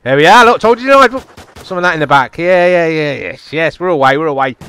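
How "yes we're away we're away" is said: ordinary talk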